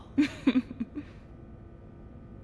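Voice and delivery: high, breath-filled giggle; amused giggle. Acted. Teasing a coworker about a masterful compliment, amused giggle. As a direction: giggle lightly, letting it tail off.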